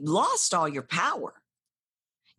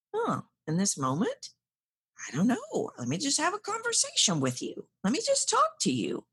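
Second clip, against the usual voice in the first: light, higher, breezy and easygoing; casual easy; 8 semitones higher than usual. Shown as a demonstration. Coach demonstrating the relaxed way to open, breezy. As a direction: shrug it off, just chatting. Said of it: "Rather than".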